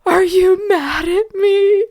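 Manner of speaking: put-on high, slow childish wail; hammed-up tearful whine; imitated crying. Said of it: "I'm crying and I just say".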